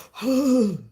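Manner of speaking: playful lion sound; lion roar imitation. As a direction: huff out a pretend lion roar. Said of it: "that's how they sound".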